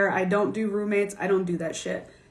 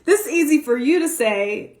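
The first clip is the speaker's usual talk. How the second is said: louder, higher pleading protest; incredulous protest; 4 semitones higher, 4 dB louder than usual. Retold or imitated. To a wealthy client, incredulous protest. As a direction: laugh off her advice in disbelief. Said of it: "I said".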